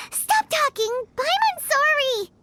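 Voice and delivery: panicked apology. Acted. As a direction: beg him to stop, spooked. Frightened by warning of danger.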